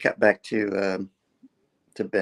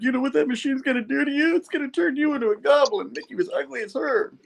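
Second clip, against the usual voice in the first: high-pitched comic character voice; quick, high-pitched retold pleading; Peter pleading to reason. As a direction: reason urgently in a squeaky mock voice. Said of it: "like, trying to reason, reason with him".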